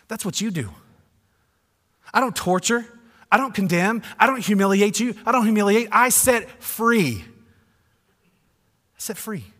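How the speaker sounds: insist, then soften to release; mock upset Jesus. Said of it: "I would have gotten really upset".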